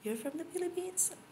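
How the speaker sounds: high, surprised quoting voice; quoted question. Retold or imitated. To a live audience, retelling a stranger's question, high and quizzical.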